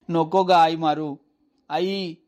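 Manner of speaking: put-on loud, slow pleading wail of a beaten boy; crying out in pain. Boy begging his mother to stop hitting, loud slow wail. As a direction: beg her to stop, drawing out each cry. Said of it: "मी ओरडू लागलो".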